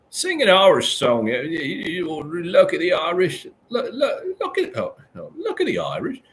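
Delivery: a badly faked Irish accent, pitched high; a poor stab at an Irish lilt, high and strained; mock Irish accent. Streamer trying an Irish accent for chat, badly faked Irish accent. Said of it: "I don't know how to do".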